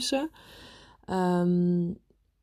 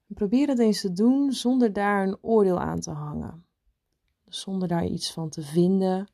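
guided meditation. Acted. Leads a meditation.

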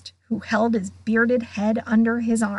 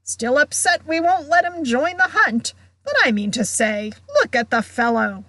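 high, boisterous character voice; mock him loudly to the whole room; jovial heckling, starting high; shouted jeer; 4 semitones higher, 4 dB louder than usual. A narrator's reading. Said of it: "shouted Sir Patrick's head".

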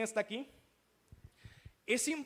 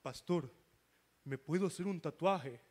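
put-on teenager; 6 semitones lower than usual. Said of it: "A veces llegan y, y preguntan".

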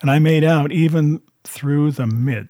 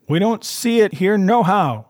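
called reply; 8 semitones higher than usual. A narrator's reading. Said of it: "returned the man".

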